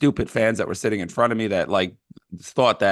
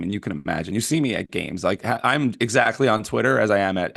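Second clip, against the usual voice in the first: ordinary talk, nothing marked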